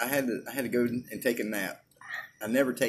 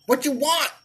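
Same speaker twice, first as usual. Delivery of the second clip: high, louder brusque server voice; mock counter server; 12 semitones higher, 4 dB louder than usual. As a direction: bark the order at the customer.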